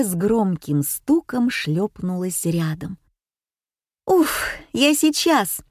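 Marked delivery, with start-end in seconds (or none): 4.1-5.6 high, slowed, out-of-puff call; calling into phone. A narrator's reading. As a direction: call into the receiver after a tumble. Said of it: "крикнула Женя в трубку". (крикнуть в трубку)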